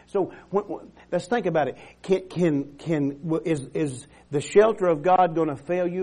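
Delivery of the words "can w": ordinary talk, nothing marked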